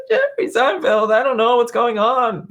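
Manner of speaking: high, loud mock dad voice; imitating dad. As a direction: mimic dad's grumbling about Jerry. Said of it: "He does that type thing".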